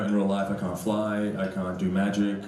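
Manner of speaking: ordinary talk, nothing marked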